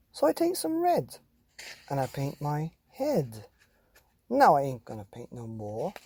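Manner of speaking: lively, sing-song picture book reading; animated storytime voice with a singsong refrain; reading to children. Acted. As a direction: read it like a bouncy bedtime rhyme to kids. Reads a picture book aloud.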